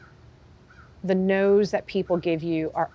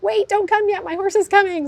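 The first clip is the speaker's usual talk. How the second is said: high, loud frantic pleading; urgent plea; 14 semitones higher, 9 dB louder than usual. Retold or imitated. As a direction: call out in mock panic, much higher and louder. Begging the finish wire to wait.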